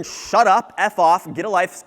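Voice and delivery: loud, punchy fed-up customer; mock angry rebuff. Fed-up customer telling a persistent salesman off, mock angry. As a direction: throw it out like an annoyed customer.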